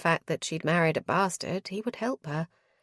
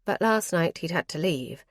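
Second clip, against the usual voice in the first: plain reading voice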